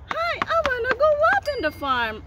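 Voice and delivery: high, quick little pig; fast high-pitched puppet voice; toy pig voice. Pretend. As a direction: play a tiny pig in a squeaky-high pitch.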